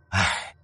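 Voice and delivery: low sighed "ai"; a low, rueful sigh; regretful sigh. A narrator's reading. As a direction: heave a scholarly sigh. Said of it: "沈仲叹道".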